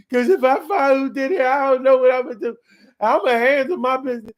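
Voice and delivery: high, loud mock-tearful plea; badly faked crying. Guilty parent begs on camera for information, badly faked crying. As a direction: beg the public, hamming up the tears. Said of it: "they don't be getting the cry off".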